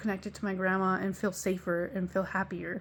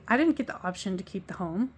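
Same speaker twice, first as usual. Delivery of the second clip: ordinary talk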